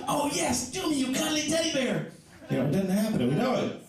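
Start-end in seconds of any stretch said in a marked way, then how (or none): 0.1-2.1 much higher breathless-sounding lady's cry; mock hot chick. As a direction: ham up a swooning woman in bed. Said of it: "a hot chick go".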